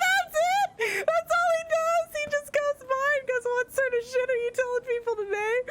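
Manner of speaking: high, laughing squeaky retelling. Real, not acted. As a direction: squeal through giggles. Cracking up retelling heckler.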